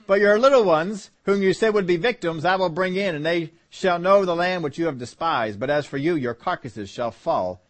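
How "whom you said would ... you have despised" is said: usual speaking voice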